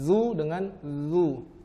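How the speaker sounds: slow letter drill; zai versus zal. Shown as a demonstration. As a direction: contrast the whistled "zu" with the plain one.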